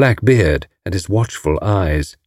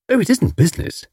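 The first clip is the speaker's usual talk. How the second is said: high, light cheerfulness; cheerful; 11 semitones higher than usual. A narrator's reading. Tuppence brushing off her husband's objection, cheerfully. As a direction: chirp it brightly, high-pitched. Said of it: "said Tuppence cheerfully".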